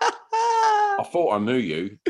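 slow, wounded disbelief half-laughing; shocked friend. Retold or imitated. Friend he shocked, slow wounded disbelief. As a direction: play her hurt, laughing through it. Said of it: "she just looked at me like".

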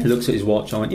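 ordinary delivery

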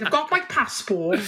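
put-on a light lilting Welsh accent with a laugh. Guest hams a Welsh tourist for the hosts, Welsh accent. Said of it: "These are good accents here".

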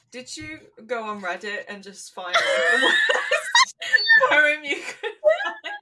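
breaking into loud high laughter; laughing. Pretend. Imagined reply to a terrible Valentine poem, laughing loudly. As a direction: shout it while cracking up.

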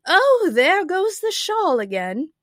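high, loud, drawn-out cry of dismay; exclaiming Queen. A narrator's reading. The Queen sees her shawl blow away, crying out. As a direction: wail it slowly in dismay.

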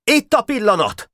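high, excited signal; urgent cry. A narrator's reading. Boka seeing his chance to attack, urgent cry. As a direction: announce the moment in a high cry. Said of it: "kiáltotta Boka".

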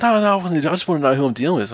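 high, hurried mock of a nervous driver; imitating the other guy's jumpy high voice; backpedalling driver. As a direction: squeak out a defensive excuse. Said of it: "He said".

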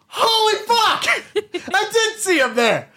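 high, laughing "holy fuck"; real laughing amazement; laughing disbelief. Real, not acted. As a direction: crack up in shock.

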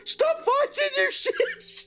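very high squealed yell; mock yell. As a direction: squeal it out to break up a fight. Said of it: "he yelled".

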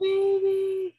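high, slow, tender and amazed; tender awe. Retold or imitated. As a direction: coo it over a newborn. Mother meets newborn, tender.

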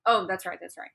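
ordinary talk, nothing marked

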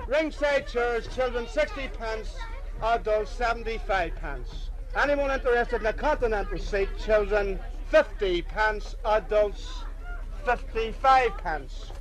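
high, slow barker's call; calling out prices. Real, not acted. Ticket seller calling out prices.

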